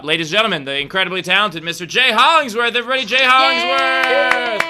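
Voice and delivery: building to a high, loud stage call; announcer introduction. Real, not acted. Introducing comedian to the audience.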